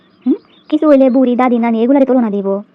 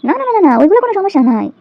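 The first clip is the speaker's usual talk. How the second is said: sarcastic protest; 7 semitones higher than usual. Acted. Sarcastic denial.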